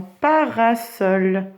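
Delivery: parasol slowly as pa-ra-sol; syllables marked. Shown as a demonstration. Teacher asking for a count, parasol in three beats. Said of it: "combien il y a de syllabes?".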